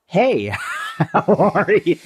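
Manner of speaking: high, hammed-up friendly hello; disarming greeting. Replaying the greeting that disarmed a mugger, high and chirpy. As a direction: sing it out high with a laugh. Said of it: "disarmed him with".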